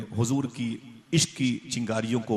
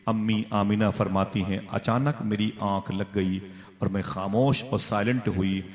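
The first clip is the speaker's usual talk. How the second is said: normal talking voice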